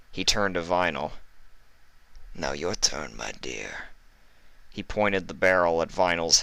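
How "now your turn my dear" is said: put-on low, whispered northern-accented thug; menacing northern heavy. Iceberg promising cruelty to the captives, low whispered menace. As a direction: purr it, low and sinister.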